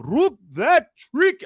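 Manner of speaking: very high, stretched-out mock Middle Eastern chant; terrible Middle Eastern accent. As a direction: imitate a Saudi crowd chanting in a bad Middle Eastern accent. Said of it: "That's my terrible Middle Eastern accent".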